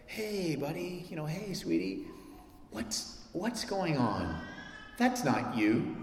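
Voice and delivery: put-on soft, slow tender voice to a child; gentle parent. Parent softly correcting a misbehaving child. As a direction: coax the child kindly.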